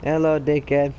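loud, cocky hello to the driver; mock-friendly loud greeting; cheeky greeting. Real, not acted.

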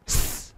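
"S" as a bare, soft hiss; voiceless S sound. Shown as a demonstration. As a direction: sound out "S" alone, with no vowel. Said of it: "S này được phát âm là".

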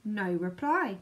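ordinary delivery, nothing marked

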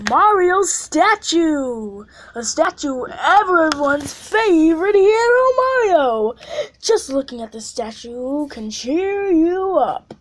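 high, loud showcase announcer read; grand prize announcement. Acted. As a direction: proclaim it like a big unveiling. Reads out prize description.

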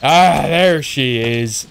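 drawn-out pirate "Ah, there she is"; slow growly pirate drawl. Real, not acted. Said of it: "I sound a bit like a pirate then".

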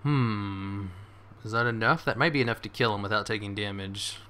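normal talking voice

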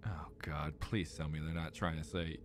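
dreading whisper. Real, not acted. Dreading the puzzle answer.

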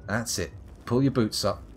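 usual speaking voice

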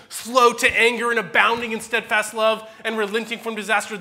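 Jonah's prayer read loud and pleading; impassioned Jonah. Acted. Pastor voicing Jonah begging God to take his life, loud and pleading. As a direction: pour out the prophet's bitter complaint at full volume.

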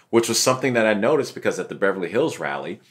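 usual speaking voice

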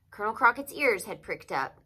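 ordinary delivery

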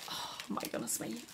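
whispered oh my goodness, awestruck; breathless whisper. Real, not acted. Vlogger peeks into the box, whispered in awe. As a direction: barely breathe the words. First glimpse inside the box.

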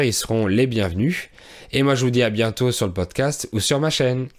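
ordinary talk

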